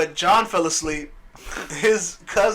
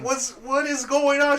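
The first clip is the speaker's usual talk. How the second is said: baffled; 9 semitones higher than usual. Retold or imitated. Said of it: "I was like".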